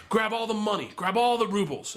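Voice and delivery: high-pitched, insistent commands; retold urgent order. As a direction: urge an accomplice on. Retold gangster ordering the robbery.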